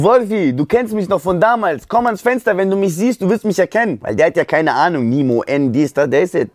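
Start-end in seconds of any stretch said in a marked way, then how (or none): 0.0-4.0 high, pleading call to a window; calling up to a friend hiding inside. Retold or imitated. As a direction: coax him to the window, voice up high. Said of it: "Ich so".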